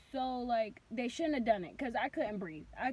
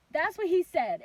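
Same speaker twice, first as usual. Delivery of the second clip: usual speaking voice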